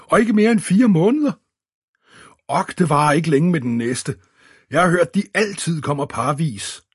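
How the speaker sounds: high, loud, gushing excitement; delighted amazement. A narrator's reading. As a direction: enthuse down the phone in wonder. Roger exclaims delightedly on phone. (høj, begejstret forundring)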